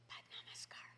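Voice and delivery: whispered under the breath; under his breath. Retold or imitated. Swami on stage whispers to the singers. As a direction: breathe the word, barely voiced. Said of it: "under his breath".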